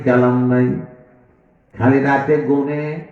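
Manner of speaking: usual speaking voice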